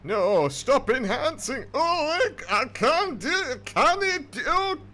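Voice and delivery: high, loud, strained northern English wail; maximum northern English accent. Acted. Gamer begging the enhancing to stop, in a frantic northern English accent. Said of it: "that's maximum northern capacity".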